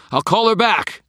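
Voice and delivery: high, loud and strained; strained gasp. A narrator's reading. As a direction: force it out mid-struggle. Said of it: "I managed to gasp".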